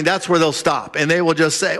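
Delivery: normal talking voice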